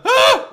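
high, loud imitation squeal of excitement; Jerry Lawler excited squeal. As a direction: squeal like an overexcited announcer. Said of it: "like, when he's excited".

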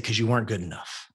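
low and soft, matter of fact; blunt verdict. Retold or imitated. Coach telling the boy he wasn't good enough, low and soft. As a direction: deliver it quietly and bluntly.

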